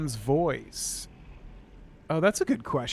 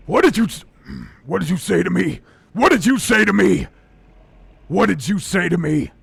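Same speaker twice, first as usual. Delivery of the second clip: menacing orc threat; 2 semitones higher, 7 dB louder than usual. Shown as a demonstration. Orc demo threatening the listener, loud and angry. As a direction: confront him like an angry brute. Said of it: "that already sounds better".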